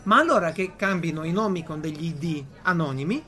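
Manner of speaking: ordinary talk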